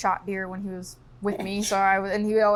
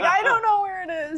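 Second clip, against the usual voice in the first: laughing helpless whine; 10 semitones higher, 4 dB louder than usual. Retold or imitated. Kid lost in the scope.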